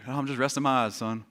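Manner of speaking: a dad half asleep on the couch mumbling; sleepy old dad. Retold or imitated. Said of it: "resting your eyes is old man speak".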